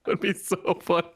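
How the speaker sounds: usual speaking voice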